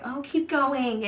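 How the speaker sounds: mocking, slow, high sing-song voice; mocking commenter. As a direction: drag it out high to mock the commenters. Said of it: "trying to be funny".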